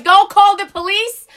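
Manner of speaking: high, loud defiant shout; retold yell at the gate agent, fading at the end; screaming back. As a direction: shout her down in fury. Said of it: "I'm like".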